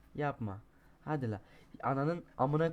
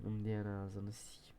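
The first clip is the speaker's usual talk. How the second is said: lower, softer whispered curse; 5 semitones lower, 7 dB quieter than usual. Real, not acted. Gamer cursing the game under his breath, whispered. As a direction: hiss it under your breath.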